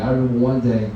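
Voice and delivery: ordinary talk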